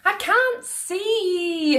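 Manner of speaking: put-on high, slow childish wail; a small boy who can't see over the crowd. A narrator's reading. Boy wailing to Mum behind the soldiers' hats. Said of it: "he wailed".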